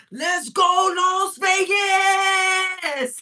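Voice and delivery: high, loud, long-held holler; imitated instructor scream. Imitating a Zumba instructor with a headset mic, screaming. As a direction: stretch it out like a hype-man scream. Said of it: "he'd be screaming".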